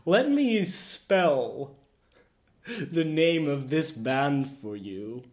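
drawn out, teasing setup; slow dramatic reveal. Real, not acted. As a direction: savour the reveal slowly.

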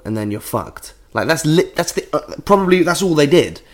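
ordinary talk, nothing marked

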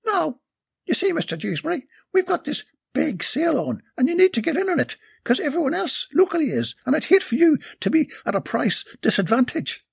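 put-on bright, eager young saleswoman pitch; sweet-talking Irish sales lass; high saleswoman voice.

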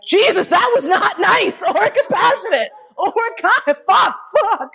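high, giggling "fuck, fuck"; laughing self-scold. Retold or imitated. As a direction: laugh at your own rudeness. Laughing at her own bluntness.

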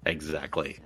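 deep dad voice; low fatherly voice. Pretend. Same low voice.

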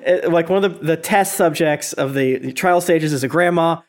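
normal talking voice

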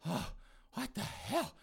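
high, weak groaning fragments; dazed, coming to. Acted. Mother coming round after being tased, dazed. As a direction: groan haltingly as if waking from a shock. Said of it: "Mama comes to her senses".

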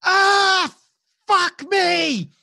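wounded soldier screams out in agony; pained scream. Acted. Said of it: "You hear Knapp screaming".

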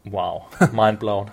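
slow, dry sarcastic wow; deadpan fake awe; mock amazement.

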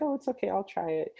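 put-on high, light kid's voice offering to try; a hint of a small boy agreeing brightly; eager child. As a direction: play the keen young boy saying yes. Young self answering on set.

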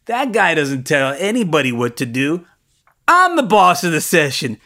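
building to a loud, high command; rising from firm to forceful; insistent boss. Retold or imitated.

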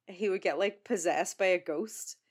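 ordinary talk, nothing marked

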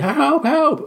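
high-pitched cry for help; mock screaming. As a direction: act out someone trapped, screaming high. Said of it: "and he's screaming".

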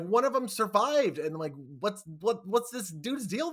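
high-pitched, incredulous questioning; baffled excitement. Retold or imitated. Viewer recalls spotting an unknown creature, baffled high-pitched excitement. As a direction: let the voice leap up in bewilderment. Quotes own baffled reaction while watching.

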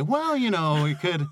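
high, sing-song talking-down tone; sweetly condescending, like soothing a failure; patronizing. Retold or imitated. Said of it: "Being patronized every day".

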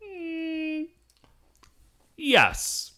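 put-on delighted kid voice. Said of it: "And Ichitaru's like".

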